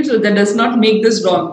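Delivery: ordinary delivery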